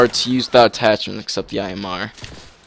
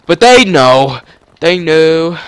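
louder, sing-song they know; loud teasing; 5 semitones higher, 10 dB louder, slower than usual. Pretend. Gamer taunting unaware enemy, loud and teasing.

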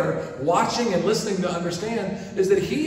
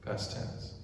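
whispered; 14 dB quieter than usual. Real, not acted. Preacher drops to a hush.

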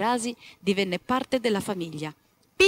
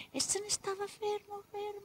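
hushed; 11 semitones higher, 11 dB quieter than usual. A narrator's reading. Rabbit hiding still.

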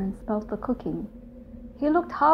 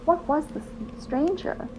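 plain reading voice

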